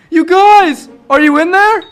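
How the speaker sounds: high, loud searching shout; shouting to someone. Acted. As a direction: holler to friends trapped inside. Shouting to friends trapped inside.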